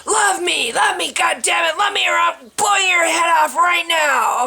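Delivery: shouted high, gunman yelling a threat; retold screaming threat. Gunman screaming at the speaker, loud high shout. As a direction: scream it like a man with a gun. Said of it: "starts screaming at me".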